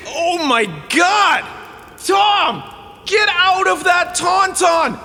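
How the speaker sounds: panicked shout. Acted. Camper yelling at Tom in the Tauntaun, shrieking panic. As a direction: scream at him in horror and disbelief.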